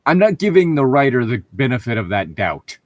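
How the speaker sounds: normal talking voice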